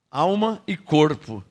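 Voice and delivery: ordinary talk, nothing marked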